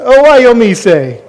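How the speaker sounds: quick loud "Oh, why, yo, me, say" run together; fast run-through of the taught phrase. Shown as a demonstration. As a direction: rattle off "Oh, why, yo, me, say" fast and bright. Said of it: "Say it really fast".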